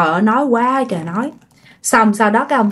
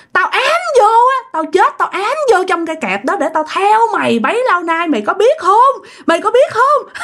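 high-pitched, gloating ghost confession; acted spirit's menacing reveal; vengeful taunting; 9 semitones higher than usual. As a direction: taunt her with your secret curse, pitch soaring. Spirit reveals hairclip haunting.

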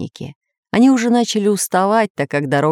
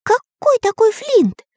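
very high, slowed bewilderment; squeaky, drawn-out surprise; astonished question; slower than usual. A narrator's reading. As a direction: ask it in a high, amazed cartoon voice. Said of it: "сев от удивления на землю".

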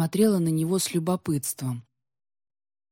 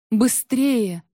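loud, high urging cry; urgent, exultant; 6 semitones higher, 5 dB louder than usual. A narrator's reading. Queen urges on the wolf she rides, excited. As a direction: urge the mount on eagerly. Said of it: "выдохнула Софья ему в шерсть".

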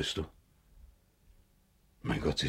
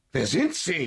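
desperate demand; 9 semitones higher, 3 dB louder than usual. Acted. Demanding the stranger's identity.

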